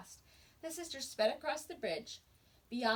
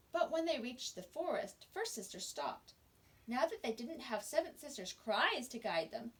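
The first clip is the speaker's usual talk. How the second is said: plain reading voice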